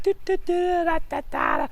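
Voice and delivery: high, loud sing-song clown noises; circus clown. Retold or imitated. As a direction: bounce around like a silly clown. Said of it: "like a circus clown".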